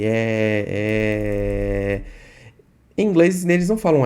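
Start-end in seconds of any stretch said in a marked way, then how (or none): 0.0-2.0 long held "é" while searching for words; drawn-out filler. Shown as a demonstration. Teacher showing how people hesitate in chat, drawn-out "é". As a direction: stall on a stretched, level "é". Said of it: "eu fico fazendo assim".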